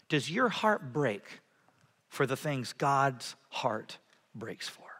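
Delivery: sinks into a soft whisper; hushed question. Real, not acted. Preacher drops to an intimate question.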